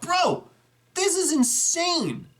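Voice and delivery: he calls the viewer bro, floored by the setup; blown away. Real, not acted. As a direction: let the awe pour out slowly. Awed by a huge collection.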